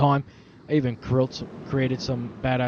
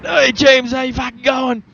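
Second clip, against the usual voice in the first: loud, high-pitched shout to a mate; yelled hello, pitched way up; calling out; 10 dB louder than usual. Real, not acted. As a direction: holler a greeting across the distance. Calling out to his mate James.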